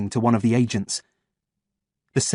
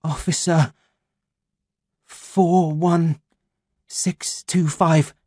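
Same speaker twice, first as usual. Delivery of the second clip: dazed recital; 6 semitones higher, much slower than usual. Acted. Delirious man reciting badge number.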